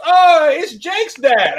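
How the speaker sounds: put-on slow, high French-accented greeting. Stranger at Brick Fest greeting Jake's dad, thick French accent. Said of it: "a thick French accent".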